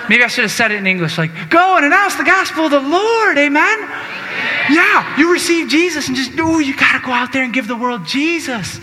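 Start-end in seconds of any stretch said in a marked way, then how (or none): 1.5-8.8 high, loud rallying cry; full-force preaching. Acted. Preacher sending the faithful out, loud and high. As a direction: fire them up to go out.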